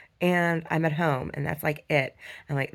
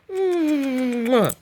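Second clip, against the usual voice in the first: kiss noise; 7 semitones higher, 4 dB louder than usual. Real, not acted. Kiss to dog.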